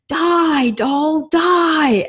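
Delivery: put-on playful child's yell of die doll die; kids yelling. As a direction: shriek it like a gleeful child at play. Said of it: "they would yell".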